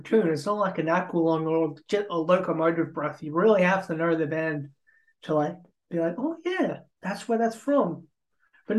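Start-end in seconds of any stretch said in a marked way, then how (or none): 6.2-8.1 high, slow mock "oh yeah" of sudden recognition; drawn-out, high-pitched aha; mock realisation. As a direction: play a fan suddenly placing the tune. Imagined fan recognising the song.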